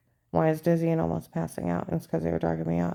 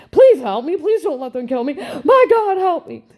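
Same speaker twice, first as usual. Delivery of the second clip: high, loud, desperate pleading in mock tears; tearful begging; 12 semitones higher, 11 dB louder than usual. Drugged Karen pleading with the agent, high tearful begging. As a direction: beg for your life through tears. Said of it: "Full tears, begging and pleading".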